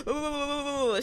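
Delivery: high, drawn-out shivery ooh; shivering. Retold or imitated. Patient quivering on the operating table, mimed shiver. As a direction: imitate shivering with a long high wail. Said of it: "I'm quivering right now".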